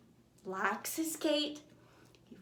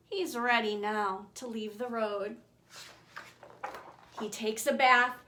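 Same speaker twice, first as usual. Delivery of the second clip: ordinary delivery, nothing marked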